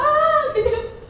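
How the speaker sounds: high, loud words through laughter; laughing crowd. Retold or imitated. Disciples laughing at the coin in the fish's mouth, high and loud. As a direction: laugh it out like amused onlookers. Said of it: "Orang ketawa".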